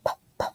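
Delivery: p, p as soft puffs of air, no vowel; quiet phonics p sounds. Shown as a demonstration. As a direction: breathe out a bare p. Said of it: "let's start with p".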